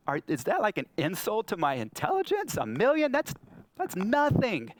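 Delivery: high-pitched, incredulous scoff; hammed-up indignant disbelief; acted mock offence. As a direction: play affronted at how small the request is. God scoffs at small request, acted.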